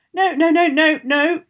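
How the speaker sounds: high, loud pleading bird voice; crow. Acted. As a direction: squawk it out as a frightened crow. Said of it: "cried the raven".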